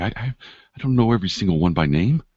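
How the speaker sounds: high, stuttering, pleading voice; frightened stammer. Acted. Pinned hospital worker excusing himself to his attacker, frightened stammer. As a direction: stammer anxiously up high, begging to be believed. Frightened captive answers the intruder.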